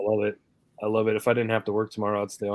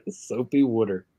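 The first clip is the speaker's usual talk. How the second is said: mimicking Pennsylvania twang. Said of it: "Pennsylvania twang".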